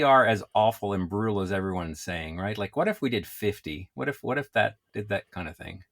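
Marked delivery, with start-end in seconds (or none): none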